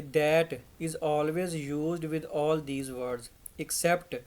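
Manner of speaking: very slow, word by word; dictating pace; slow dictation. Shown as a demonstration. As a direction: spell out the rule slowly for notes. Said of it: "teacher stressing rule slowly".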